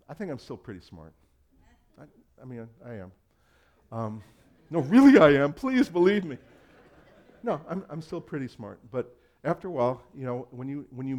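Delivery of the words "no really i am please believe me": louder, higher, jokingly begging to be believed; playful over-the-top insistence; mock pleading. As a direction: ham up a desperate plea.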